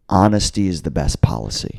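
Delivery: low, measured statement of principle; earnest calm assertion. Retold or imitated. Teller quotes himself advising stoner friends, calmly earnest. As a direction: lay it down low and matter-of-fact.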